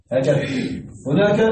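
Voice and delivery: usual speaking voice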